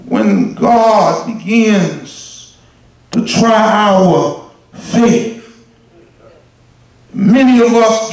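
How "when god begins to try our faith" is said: slow right down, hushed. Acted. Slow quiet preaching.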